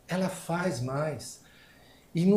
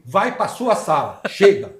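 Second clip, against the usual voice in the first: alto e firme, encerrando a discussão; ordem ríspida; 5 semitones higher, 10 dB louder, slower than usual. Retold or imitated. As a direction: corta a conversa com autoridade. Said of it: "Eu falei". (chefe manda a funcionária sair, firme e alto)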